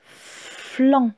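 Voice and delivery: syllabe seule, détachée et un peu plus aiguë; répéter le mot de la dictée; dictée articulée. Shown as a demonstration. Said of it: "Qui va s'écrire". (voix de dictée nette)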